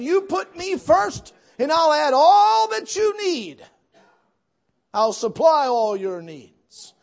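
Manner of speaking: high, loud, slow pulpit preaching; a full-throated revival preacher; preaching at full force. Acted. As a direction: proclaim it to the whole church. Quotes God at full preaching force.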